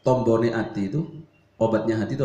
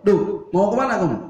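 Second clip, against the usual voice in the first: higher, louder guard's challenge; stern challenge; 7 semitones higher, 5 dB louder than usual. Acted. Umar halting the woman at the gate, raised challenge. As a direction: call her out like a gate guard.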